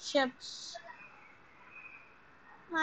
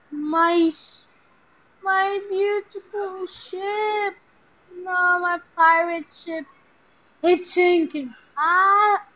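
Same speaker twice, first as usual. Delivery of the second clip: mock lament; 4 semitones higher, 10 dB louder, slower than usual. Real, not acted. Mourning his sinking ship.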